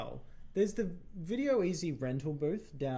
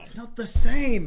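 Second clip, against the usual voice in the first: banging the desk; 7 semitones higher, 17 dB louder, slower than usual. Real, not acted. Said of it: "Stop hitting the desk".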